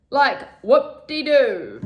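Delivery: loud, slow sarcastic whoop-de-doo. Real, not acted. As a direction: drag out a sneering whoop-de-doo. Sarcastic about promoting products.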